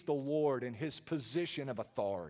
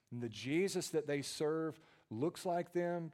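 ordinary talk

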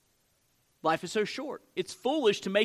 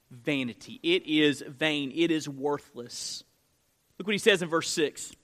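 ordinary delivery

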